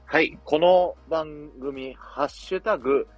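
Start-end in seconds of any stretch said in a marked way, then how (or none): none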